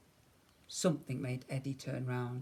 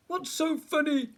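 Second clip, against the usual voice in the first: high, indignant question; frowning bear; 13 semitones higher, 4 dB louder than usual. A narrator's reading. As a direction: ask it with a frown, pitched up. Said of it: "asked the bear with a frown".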